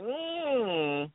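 drawn-out high "mmm"; savouring "mm". Retold or imitated. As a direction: hum it high and hold it. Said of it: "I was like".

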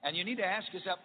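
usual speaking voice

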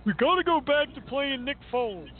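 high-pitched mock sports-radio caller; mocking radio schmucks. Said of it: "heard so many schmucks on radio today say".

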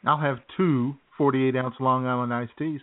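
ordinary talk

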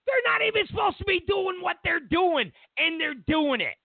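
indignant shout. Real, not acted. Ranting about China.

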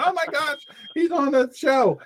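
high, gushing excitement; excited squeal. Retold or imitated. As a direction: imitate a thrilled wife. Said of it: "she's dying right now going".

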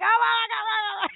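very high hammed-up wail of pain; retold agony, shrieked for laughs; mock crying shout. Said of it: "and I'd be crying".

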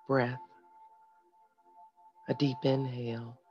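soft, calm breathing guide; guided meditation. Acted. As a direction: lead them gently through each breath. Leads a breathing exercise.

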